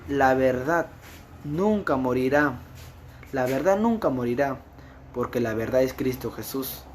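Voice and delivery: loud, ringing sermon voice; fervent preaching. Acted. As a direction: proclaim it to the congregation. Preaching at full force.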